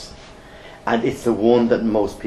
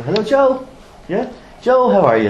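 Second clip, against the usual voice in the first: building rapport; 12 semitones higher, 6 dB louder than usual. Shown as a demonstration. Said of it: "now I'm building rapport straight away".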